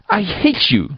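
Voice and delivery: high, choked angry outburst; child's high-pitched fury; choked cry of hatred. A narrator's reading. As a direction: cry it out through choking fury. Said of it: "cried in a choked voice".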